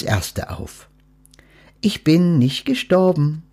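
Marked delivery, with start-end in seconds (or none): 1.8-3.4 lightly raised private thought; thought to herself. A narrator's reading. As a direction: think it to yourself, surprised. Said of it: "dachte sie bei sich".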